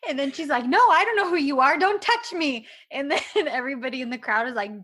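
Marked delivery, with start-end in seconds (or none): 0.7-2.7 mock denial. She trolls him.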